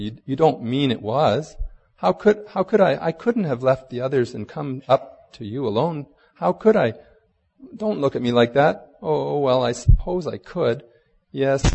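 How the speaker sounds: lightly acted, stammering self-defence; flustered protest. A narrator's reading. Lucy defends herself to Aslan, in mildly flustered protest.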